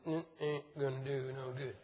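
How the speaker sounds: soft, mumbly, laughing mock Biden; Joe Biden impression. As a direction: trail off into a chuckle like an old politician. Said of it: "That's my best Joe Biden imitation".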